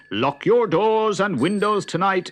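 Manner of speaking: loud, high urgent warning shout. A narrator's reading. Policeman on his bicycle warns the villagers, shouting. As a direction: call it out to the whole street. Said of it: "rode along the street on his bicycle shouting".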